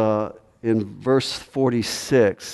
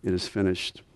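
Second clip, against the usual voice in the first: hushed and soft; 4 semitones lower, 7 dB quieter than usual. Acted. Preacher to congregation, repeating the cross words in a hushed voice. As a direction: drop to a near hush.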